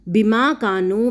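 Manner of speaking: slow recitation of بما کانوا; careful tajweed reading; recited slowly. Shown as a demonstration. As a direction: spell out بما کانوا syllable by syllable. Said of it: "بیما،".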